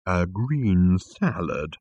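"green salad" slow and drawn out; "A green salad" stretched out disapprovingly. Acted. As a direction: echo "green salad" with weary disdain. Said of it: "rolling his eyes disapprovingly".

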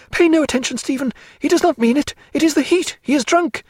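very high, urgent woman's voice; crying out. A narrator's reading. Diana pushing Canning back, calling to Stephen, urgent. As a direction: plead urgently at high pitch. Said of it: "crying out".

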